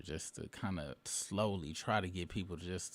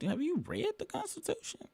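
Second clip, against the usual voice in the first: mocking question. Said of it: "I be like".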